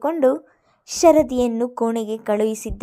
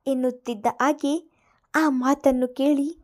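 ordinary delivery

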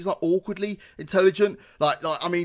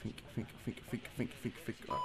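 urgent whispered think, think; whispering while hiding; 7 semitones lower, 16 dB quieter than usual. Real, not acted. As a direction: whisper it to yourself, tense. Hiding, panicked self-talk.

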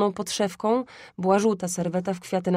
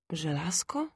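hushed, puzzled echo rising at the end; whispery question, doubting your ears; unsure questioning; 7 dB quieter than usual. A narrator's reading. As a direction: repeat it softly in disbelief. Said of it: "niepewny, czy dobrze słyszę".

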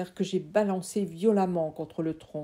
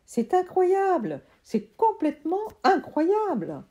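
amazed uncle; 11 semitones higher, 6 dB louder than usual. Acted. Uncle marvelling at sprouting seeds, high loud amazement. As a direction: gasp it out in wonder. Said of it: "n'arrêtait pas de répéter".

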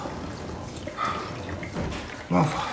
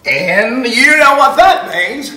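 normal talking voice

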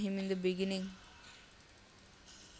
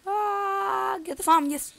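high, loud burst of glee; excited cheer; 12 semitones higher, 8 dB louder, slower than usual. Real, not acted. Gamer cheering himself on, excited. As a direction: cheer it out like you just scored. Cheering own farming in game.

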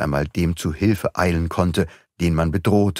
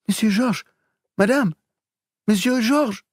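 distressed nursemaid; 13 semitones higher than usual. A narrator's reading. Nursemaid bursts in, upset.

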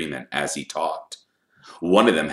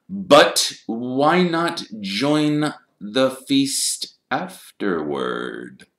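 very slow, high start sinking at the end; probing Snape; 9 semitones higher, 7 dB louder, much slower than usual. A narrator's reading. Snape picking at the pupils' alibi, slow and probing. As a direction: draw the question out suspiciously.

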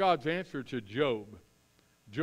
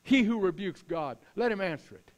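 raised, commanding divine challenge; Lord's voice; 5 semitones higher, 5 dB louder than usual. Acted. God demanding Job's answer, high and stern. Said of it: "the Lord answered Job".

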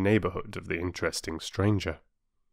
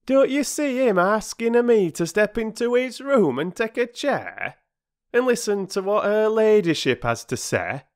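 high, loud sarcastic porter; mock it with wounded outrage; huffy working man in full grievance; indignant; 5 dB louder than usual. A narrator's reading. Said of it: "said Perks indignantly".

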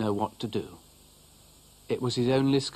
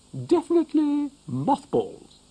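much higher and louder, sing-song matron; motherly cow soothing; 6 dB louder than usual. Acted. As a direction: cow calms the upset dog, dear. Cow advising.

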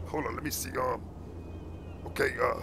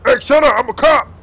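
shouted, louder and higher; a mock cop loses his temper; angry shout; 6 semitones higher, 10 dB louder than usual. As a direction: bark it at him to shut him up. Officer yells at mocking driver.

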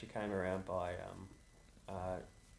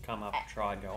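usual speaking voice

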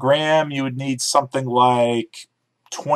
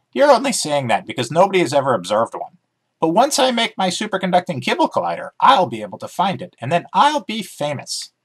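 higher, quick puppyish voice; chirpy dog character, keen to explain; eager talking dog; 7 semitones higher than usual. Acted. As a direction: bounce through it like an excited dog. Dog Emmy voiced in story.

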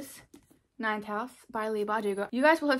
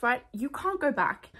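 ordinary delivery

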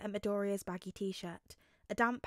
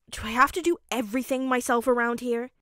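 exasperated; 2 semitones higher, 8 dB louder than usual. Acted. Child imitating exasperated parent.

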